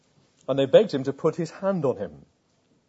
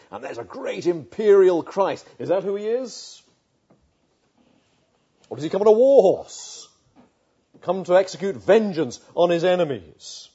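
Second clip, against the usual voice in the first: grand declaiming; 3 semitones higher, 7 dB louder than usual. Acted. Preacher evokes grand imperial Christ.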